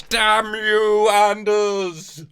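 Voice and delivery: theatrical mock damning; mock curse. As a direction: shake your fist at Anders in jest. Cursing Anders Celsius theatrically.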